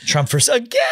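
high, disbelieving rise on again; mock-shocked at a rerun; incredulous. Real, not acted. Friend hearing the election pick, high incredulous rise.

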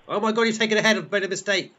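very high, loud outburst; alarmed exclamation. Pretend. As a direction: cry out as you're overtaken. Host overtaken on last lap.

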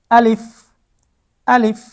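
আলিফ quick, with air released on the final f; airy final f. Shown as a demonstration. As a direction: let a little air out between lip and teeth ending আলিফ. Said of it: "মাঝখান দিয়ে একটু বাতাস". (আলিফ-এর শেষে একটু বাতাস বের করে)

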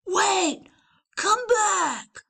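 high shout after him; calling out. Acted. Leon to fleeing Valentine, shouting. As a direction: yell to stop the runaway. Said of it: "chasing the Valentine".

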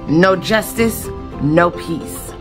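ordinary delivery

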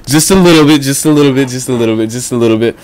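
loud, furious repeated yell; angry yelling. Real, not acted. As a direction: yell it out in frustration. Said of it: "I got a little bit angry".

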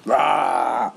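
ordinary delivery

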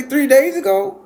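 shouted, high-pitched question; acted shock. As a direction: cry out in utter disbelief. Shocked at news of death.

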